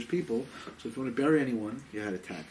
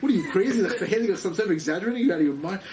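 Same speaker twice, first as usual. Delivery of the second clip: retold indignant disbelief, higher and louder; mock-offended, pitched up; incredulous; 5 semitones higher, 5 dB louder, faster than usual. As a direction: react as if they must be crazy. Acted incredulous reply to exaggeration charge.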